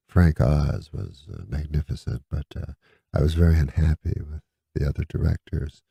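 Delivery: low, quiet, even storytelling; imitated zen-like flat voice; peaceful zen monotone. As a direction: talk softly and serenely, barely moving the pitch. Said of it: "real peacefully".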